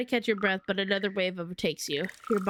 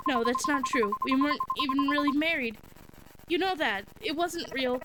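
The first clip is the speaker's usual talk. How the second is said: frantic, pitched-up protest; high panicked denial; 6 semitones higher than usual. Acted. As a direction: deny it in rising panic.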